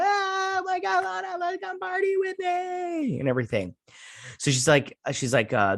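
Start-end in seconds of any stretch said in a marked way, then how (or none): 0.0-3.2 very high, slow squeaky partygoer; mock excited squealing. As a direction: squeal it drawn-out like an overexcited friend. Imitating the excited celebrating supporters.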